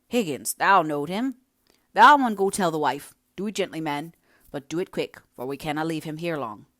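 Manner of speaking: a hint of a blunt northern man; lightly voiced workman. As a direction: press him firmly to go. Man urges Higgins to go.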